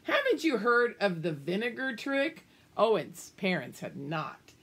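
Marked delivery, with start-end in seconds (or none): none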